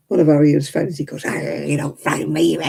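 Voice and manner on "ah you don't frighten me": mock bravado. Acts friend taunting the barking dog.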